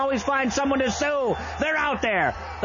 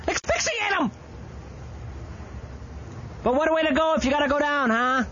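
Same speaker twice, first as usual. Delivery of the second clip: hammed-up pitch; 5 semitones higher than usual. Over-the-top ad pitch.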